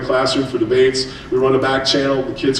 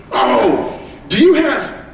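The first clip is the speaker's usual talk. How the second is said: mock surprise; 8 semitones higher, much slower than usual. Teasing the crowd about parents.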